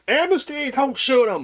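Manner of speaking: high yelled plea. Acted. Player yelling to stop a ranger shooting, high yell. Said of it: "I kinda yell".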